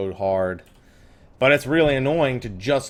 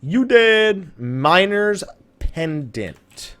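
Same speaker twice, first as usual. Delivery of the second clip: higher, louder burst of joy; excited; 6 semitones higher, 4 dB louder, much slower than usual. Real, not acted. Player reacting to the item finally dropping, excited cheer. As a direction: cheer the drop.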